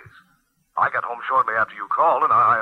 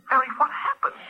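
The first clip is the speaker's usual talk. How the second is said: anxious; 11 semitones higher than usual. Acted. Eric anxious on the phone.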